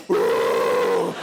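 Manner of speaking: deep, loud monster roar; Godzilla roar. Retold or imitated. Sister startled by a grip in the dark, deep Godzilla roar.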